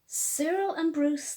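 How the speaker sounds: ordinary delivery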